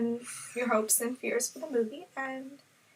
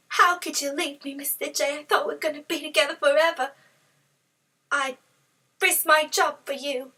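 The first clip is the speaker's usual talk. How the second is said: lightly voiced whiny girlfriend, a little higher; Harley's voice; 3 semitones higher, 4 dB louder than usual. Harley reproaches the Joker for leaving her, lightly higher and whiny. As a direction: plead like a jilted girlfriend, quick and pouty.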